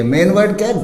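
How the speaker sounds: normal talking voice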